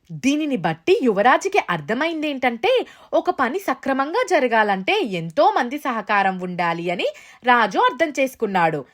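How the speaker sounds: ordinary delivery, nothing marked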